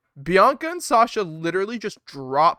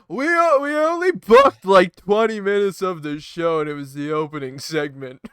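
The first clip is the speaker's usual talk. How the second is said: mock bookers; 4 dB louder than usual. Voicing WWE bookers admitting poor planning, mock sheepish. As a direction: confess it like clueless writers.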